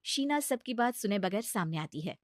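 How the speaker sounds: usual speaking voice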